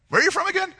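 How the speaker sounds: high-pitched, hammed-up nervous question; Pilate. Worried Pilate asks Jesus, comically high-pitched. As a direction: squeak up high, played for laughs. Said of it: "Pilate's like".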